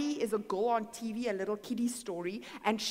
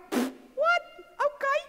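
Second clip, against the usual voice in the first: very high, loud mock disbelief; hammed-up high-pitched surprise; startled reaction; 7 dB louder than usual. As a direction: squeak it out, taken aback. Acts startled reply to God.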